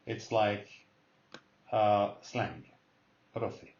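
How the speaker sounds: ordinary talk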